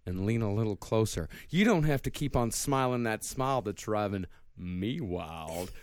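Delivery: low, quiet creepy lyric reading; calm psychopath. Acted. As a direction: read it like a calm serial killer. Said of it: "do it in the serial killer voice".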